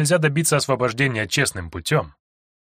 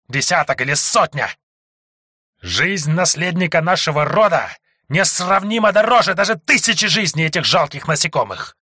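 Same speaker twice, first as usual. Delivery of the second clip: higher, louder furious snake voice; roaring snake man; 5 semitones higher, 3 dB louder than usual. Acted. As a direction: sneer народа, then roar with contempt. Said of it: "проревел Змееголовый". (ревущий от ярости змеелюд)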